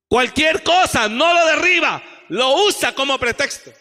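high, loud rebuke; shouted repeat. Real, not acted. Preacher rebuking half-hearted servants, shouting. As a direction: hurl the accusation at them. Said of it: "repeats louder after plain take".

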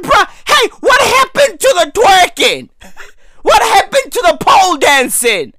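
high-pitched mock outrage; indignant complaint. Retelling his funeral complaint about no twerking, indignant. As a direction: protest as if cheated of a party.

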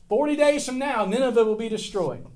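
loud, high prophet's proclamation; proclaiming doom. Acted. Jonah shouting his warning to the people of Nineveh, proclaiming. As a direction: cry the warning out to the city.

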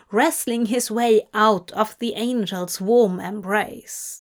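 ordinary delivery

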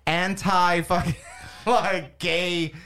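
a drawn-out outraged yell on anti-fucking; mock outraged shout. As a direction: slowly shout the words in disbelief. Retold inner outrage.

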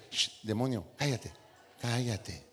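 más bajo y más suave, casi secreto; timid hush. Retold or imitated. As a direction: calla al demonio tímidamente. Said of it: "nosotros pensamos que esa reprensión". (reprensión tímida, baja y suave)

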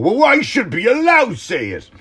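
high, loud mocking put-on whine; mocking whiny voice. Mocking his own imagined critics in a whiny voice. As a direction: sneer it out like a brat demanding rights. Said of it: "This is not me going".

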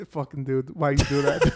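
low mock voice. Mocking the fry thrower at lunch.

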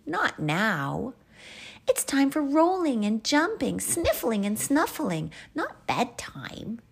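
put-on playful, insistent child character; protesting child.

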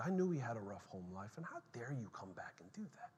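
ordinary talk

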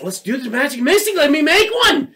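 put-on high, loud eager kid; excited child. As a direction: imitate a child excited to play. Retold child eagerly begging to play.

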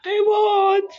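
very high, loud mock wail; crying out, re-enacted. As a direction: wail it like a bawling child. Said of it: "That's how I cried out to say".

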